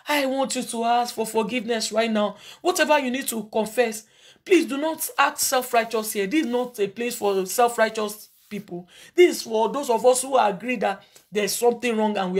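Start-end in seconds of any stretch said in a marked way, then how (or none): none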